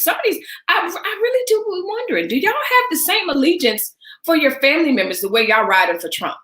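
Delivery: high-pitched exasperated disbelief; incredulous. Real, not acted. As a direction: sound like you can't believe it. Incredulous question to viewers.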